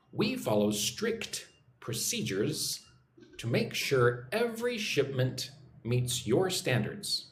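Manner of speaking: slow, measured repeat-after-me delivery; slow model reading. Shown as a demonstration. Teacher giving learners a sentence to repeat, slow and measured. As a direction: model it slowly, pausing between phrases. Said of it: "Follow along with me. Practice your English".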